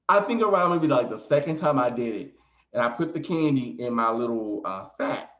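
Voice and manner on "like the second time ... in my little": ordinary talk, nothing marked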